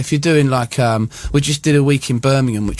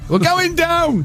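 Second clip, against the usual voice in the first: high mock yell of panic; panicked shout; 13 semitones higher, slower than usual. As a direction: scream it like the plane is falling. Panicking pilot in the cockpit.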